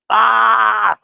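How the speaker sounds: short, loud startled yell; hammed-up panicked shout; mock yelp. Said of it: "I'm like".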